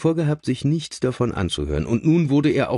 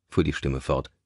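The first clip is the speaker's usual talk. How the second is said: ordinary delivery, nothing marked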